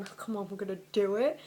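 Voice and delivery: hammed-up eager creep; mocking Ron pressing on, fast and loud; mock pushy guy.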